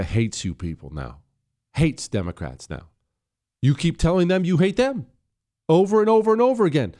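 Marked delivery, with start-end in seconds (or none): 3.6-6.9 rising higher and louder, faster on the repeats; exasperated rant. Real, not acted. Host ranting at Democrats, voice climbing louder and faster. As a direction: hammer the over and over, getting angrier.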